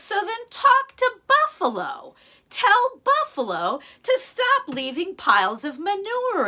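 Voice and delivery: high exasperated gecko voice; indignant gecko. Acted. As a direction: squeak back at the chief. Gecko retorts to chief.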